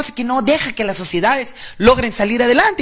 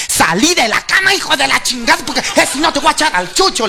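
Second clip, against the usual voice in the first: high-pitched scolding wife; imitated wife's tirade, pitched up; mock scolding yell; 5 semitones higher than usual. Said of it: "de rodillas gritándole".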